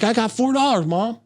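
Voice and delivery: put-on very high, gleeful child voice; imitating an excited kid. Kid showing Mom his four dollars, high excited child voice. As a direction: squeal it way up high like a proud kid. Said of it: "And he's like".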